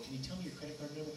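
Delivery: mild mock exasperation; impatient customer. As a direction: plead with the operator, worn out. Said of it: "the lady comes on the phone".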